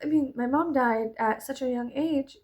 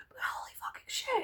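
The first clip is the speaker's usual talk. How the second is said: whisper; 13 semitones higher, 9 dB quieter than usual. Retold or imitated. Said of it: "I was like".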